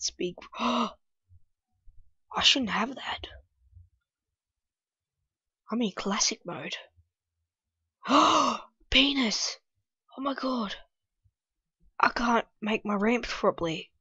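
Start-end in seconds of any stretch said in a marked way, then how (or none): none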